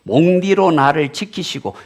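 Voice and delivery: light Gyeongsang dialect reading; a touch of Gyeongsang dialect, played for a smile; 경상도 사투리로 가볍게. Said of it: "경상도 버전에서는 뭐라고 했냐면".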